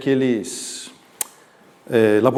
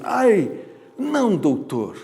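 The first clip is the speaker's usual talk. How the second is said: pained cry; 5 semitones higher, much slower than usual. Shown as a demonstration. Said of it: "eu tinha que falar bem alto".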